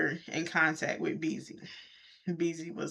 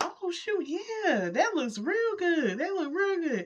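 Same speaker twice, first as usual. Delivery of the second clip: happy; 11 semitones higher, slower than usual. Retold or imitated. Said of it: "Beezy was happy".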